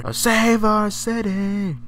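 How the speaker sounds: Doors reference. Retold or imitated. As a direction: draw it out like a rock singer's refrain. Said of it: "Doors reference".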